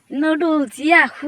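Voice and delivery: high, loud whoop over food; gleeful cheer. Acted. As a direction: cheer like a hungry kid seeing a treat. Cheering at finding noodles.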